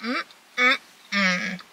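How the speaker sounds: humming with mouth full; savoring while eating; chewing food. Real, not acted. Seen 1: Fork at mouth, cheeks full, eating from bowl throughout labelled hums.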